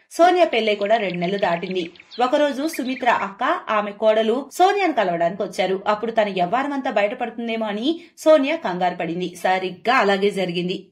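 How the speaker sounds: ordinary delivery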